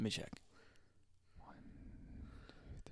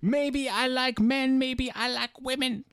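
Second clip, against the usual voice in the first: Russell Brand; 12 semitones higher, 6 dB louder than usual. Acted. Mocking an English comedian, high exaggerated accent. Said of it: "My name's Russell Brand".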